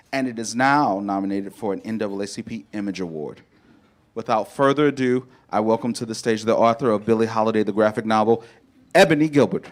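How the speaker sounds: big booming stage introduction; announcer voice. Acted. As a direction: announce the guest loudly to the crowd.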